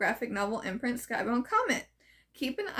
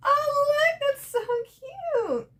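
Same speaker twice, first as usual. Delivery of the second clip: high, gasping squeal of delight; 14 semitones higher, 4 dB louder than usual. Real, not acted. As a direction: gush over something adorable. Delighted gasp at the artwork.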